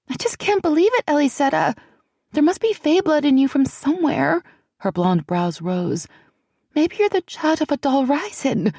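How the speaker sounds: put-on high, hushed and anxious young woman; a nervous friend fretting in a half whisper; frightened young woman. A narrator's reading. Celianne marvelling.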